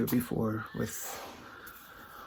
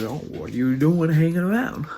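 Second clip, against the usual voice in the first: higher, louder storyteller's character voice; Brer Bear; 5 semitones higher, 8 dB louder than usual. Acted. Storyteller voicing a bear who finds Rabbit trapped, loud rising question. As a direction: call out a question, climbing in pitch. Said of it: "Brer Bear comes by and says".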